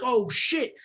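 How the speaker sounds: high-pitched, delighted exclamation; acted excitement. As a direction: exclaim like thrilled by a cheap find. Imitating delighted bargain find.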